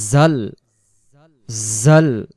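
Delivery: জাল said as jal, not a hard jim sound. Shown as a demonstration. Teacher shows a student how to say জাল. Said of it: "এই জন্য বলবো".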